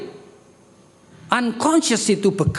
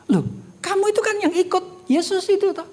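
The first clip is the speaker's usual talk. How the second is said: put-on servant girl asking; 10 semitones higher than usual. Servant girl questions Peter.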